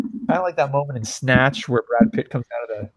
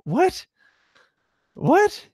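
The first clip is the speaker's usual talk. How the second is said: much higher, squeaky disbelief; incredulous what. Retold or imitated. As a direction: act baffled by the challenge. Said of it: "And I'd be like".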